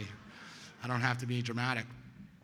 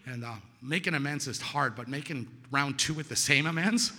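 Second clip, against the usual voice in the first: normal talking voice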